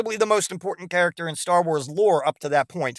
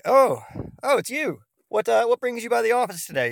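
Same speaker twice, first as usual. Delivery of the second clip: much higher, hammed-up cheery doctor; a sing-song doctor faking delight; mock doctor greeting; 8 semitones higher than usual. Doctor greets patient again.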